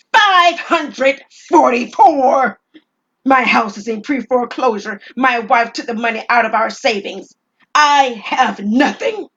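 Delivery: loud, slow, high anguished yell; despairing outburst. Acted. Robber shouting his ruin at the clerk, loud and slow. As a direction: hammer home every loss.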